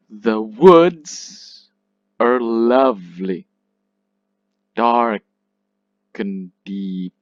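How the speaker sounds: slow scansion, WOODS and LOVE hit loud, "the" and "are" weak; stressed syllables stronger. Shown as a demonstration. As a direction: pause between beats, lean on "woods", "lovely", "Dark", "deep". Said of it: "unstressed is weak, stressed is stronger".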